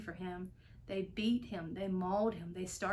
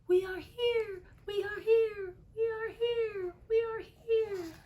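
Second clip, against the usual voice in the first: very high, small, far-off cry, slowing; tiny voices crying out; 12 semitones higher, 4 dB louder than usual. Acted. The frightened Whos cry out to be heard, high and tiny. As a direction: squeak it out desperately like tiny Whos. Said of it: "They cried out in fear".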